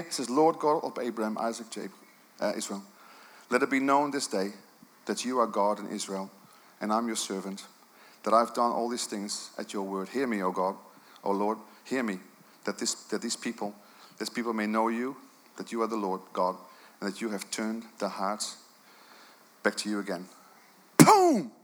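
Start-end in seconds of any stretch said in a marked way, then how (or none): 0.3-20.3 low, soft, hushed prayer; solemn prayer. Acted. Elijah's prayer voiced. 21.0-21.5 sharp, loud, high shout of Boom!; sudden boom. Real, not acted. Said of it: "That woke some of you up".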